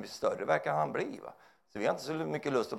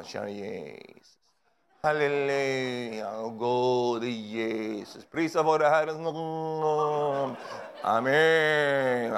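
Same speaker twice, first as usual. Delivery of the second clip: mock churchy prayer voice; much slower than usual. Preacher mocking churchgoers in pious prayer voice. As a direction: parody the holy-voice preacher. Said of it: "börjar de prata nu då".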